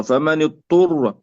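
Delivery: اضطر with ض merged into ط; اضطر with no separate ض; wrongly merged dad. Shown as a demonstration. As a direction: swallow the ض of اضطر into a doubled ط. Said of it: "لا نقول".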